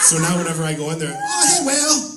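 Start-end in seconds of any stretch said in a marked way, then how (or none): 1.2-2.1 higher mock cheerful greeting; imitating masseuse. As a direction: sing out the nickname pitched up. Masseuse greeting him as Whale.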